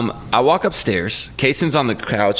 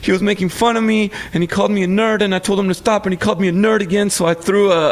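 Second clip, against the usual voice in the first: put-on higher, rushed child's account; daughter's story; 8 semitones higher, faster than usual. Dad imitates his daughter explaining the fight, higher. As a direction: tell it like a defensive kid. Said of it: "she goes".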